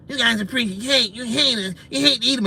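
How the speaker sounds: high, soft whiny mock voice of the critics; a hammed-up complaining opponent. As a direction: whine it like a hurt accuser.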